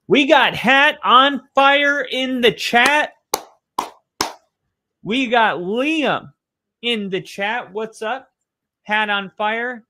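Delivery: high, loud, slow hype greeting; excited shout-out. Real, not acted. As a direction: call the viewer's name across the room with big energy. Streamer greets chat viewers.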